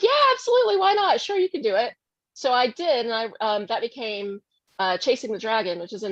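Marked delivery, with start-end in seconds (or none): 0.0-1.9 much higher, louder, bubbly yes; imitating sister's much higher, louder enthusiasm; eager sister. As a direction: gush it like an eager sister who loves the idea. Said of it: "she was like".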